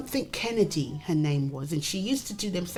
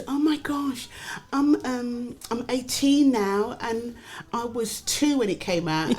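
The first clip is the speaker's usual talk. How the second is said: higher-pitched imitation of a fan; gushing young fan; 4 semitones higher than usual. Young fan meets the comedian, mock gushing. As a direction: mimic an eighteen-year-old fan, higher voice. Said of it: "and somebody goes".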